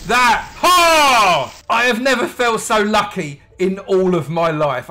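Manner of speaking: loud, high victorious outburst; elated triumph. Real, not acted. Gamer who never felt so lucky, elated triumph. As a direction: crow with delight at your luck. Triumph at second villager secured.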